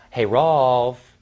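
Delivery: a light camp lilt on the greeting. Retold or imitated. Teasing his older brother all day, mild camp voice.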